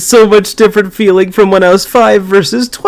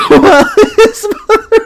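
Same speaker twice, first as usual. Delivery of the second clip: laughing; 13 semitones higher than usual. Real, not acted. Reading while laughing.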